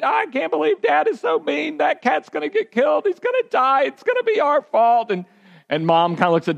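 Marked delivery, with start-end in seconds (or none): 0.0-5.1 put-on much higher, frantic kid voices without real volume; kids screaming. Kids in the back seat protest that the cat will die, mock frantic squeal. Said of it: "Then they start screaming in the back seat".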